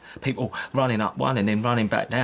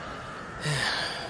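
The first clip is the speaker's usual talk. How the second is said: weary sigh; 8 dB quieter than usual. Acted. Soldier sighs at the soldiers.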